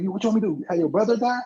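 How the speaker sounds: high-pitched acted comeback; mock incredulous retort. Arguing back to a crying child in the retelling, mock incredulous. Acting out film's family argument.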